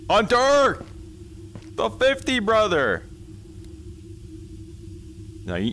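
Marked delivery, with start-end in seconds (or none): 0.1-3.0 high, loud hype call-out; excited shout. Real, not acted. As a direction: shout thanks like a hype man. Thanking donor for fifty.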